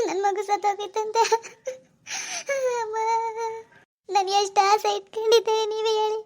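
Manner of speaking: grief-stricken wail broken by sobs; weeping. Acted. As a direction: mourn your baby, sobbing between phrases. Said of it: "ಅಳ್ಬೇಡಿ ಅಮ್ಮ, ಅಳ್ಬೇಡಿ".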